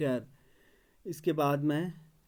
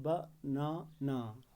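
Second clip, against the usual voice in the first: Banana slow and softer, as three separate beats ba-na-na; syllable by syllable; 7 dB quieter, much slower than usual. Shown as a demonstration. Teacher showing the tongue moves three times, syllable by syllable. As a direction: break banana into ba, na, na with pauses. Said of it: "तीन बार इस word को बोलते हैं".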